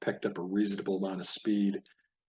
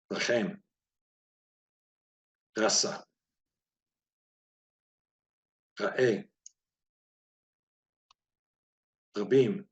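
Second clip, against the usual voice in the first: measured word drill; 4 dB louder, slower than usual. Shown as a demonstration. Teacher reading a Hebrew word list to beginners, measured drill pace. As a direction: separate words with long pauses. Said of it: "Gonna try this a little bit faster".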